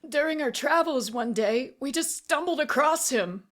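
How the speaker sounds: high, anxious explanation; anxious confession. A narrator's reading. Auctioneer recounting meeting the monster, anxious. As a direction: explain yourself nervously. Frightened man confesses while held.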